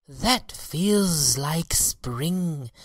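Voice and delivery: high, very slow bee voice; bees crying. A narrator's reading. The bees in the hive feel spring, high and slow. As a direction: draw it out high as the bees wake. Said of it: "cried the bees".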